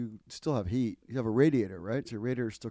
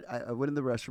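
normal talking voice

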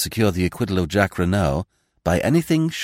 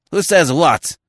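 high, loud impatient demand; cried out; 9 semitones higher, 6 dB louder, slower than usual. Acted. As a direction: snap the question impatiently. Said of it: "cried the justly".

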